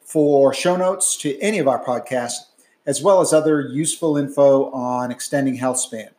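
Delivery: brisk, clear announcer read of the disclaimer; podcast outro read. Acted. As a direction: read it like a formal podcast sign-off.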